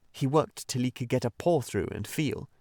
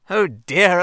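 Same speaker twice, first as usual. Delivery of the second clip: Rat, much louder and higher, despairing; despairing cry; 8 semitones higher, 11 dB louder than usual. Acted. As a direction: throw up your hands in despair. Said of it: "cried the Rat in despair".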